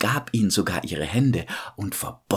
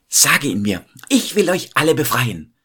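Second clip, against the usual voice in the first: raised voice, rising higher as she declares it; princess calling out; 5 semitones higher, 4 dB louder than usual. A narrator's reading. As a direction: call it out with resolve, as a storybook princess. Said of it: "rief da die Prinzessin".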